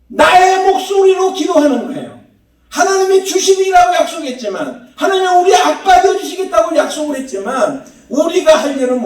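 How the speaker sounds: high, loud pulpit proclamation; impassioned preaching. Real, not acted. Preacher urges congregation to pray for themselves, forceful high preaching.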